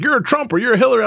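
high, quick imitation of a yeller; mock yell. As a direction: mimic an accuser, fast and high-pitched. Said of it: "one person's yelling at me".

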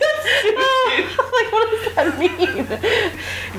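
high, giggly confused; laughing. Retold or imitated. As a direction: laugh out the puzzled question. Kid's puzzled reaction, laughing.

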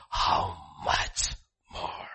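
slow whispered question. Real, not acted. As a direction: hush it, slowly. Preacher whispers rhetorical question.

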